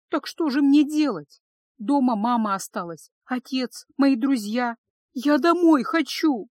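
high, loud, desperate wail building; hysterical tearful outburst. A narrator's reading. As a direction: build to a drawn-out, pleading cry. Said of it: "Девушка опять заплакала. У неё началась истерика".

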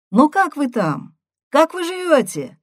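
high, loud phone chatter; chirpy sister. A narrator's reading. Sister phoning home to ask after family, high and loud. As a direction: pitch it way up and call down the line.

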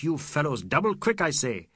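high, loud raging tirade; in an awful rage; angry cry. Acted. Aziz raging at the English, high and loud. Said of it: "and cried".

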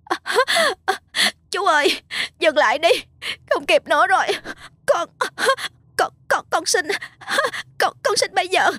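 very high, sobbing, broken cry; crying out in pain. A narrator's reading. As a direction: scream it through the labour pain. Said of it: "thét lên trong đau đớn". (thét lên đau đớn, nức nở)

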